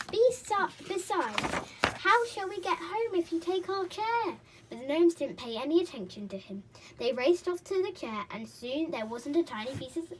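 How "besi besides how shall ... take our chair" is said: high, loud indignant protest; boy protesting indignantly. A narrator's reading. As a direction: protest the theft. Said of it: "cried Peter indignantly".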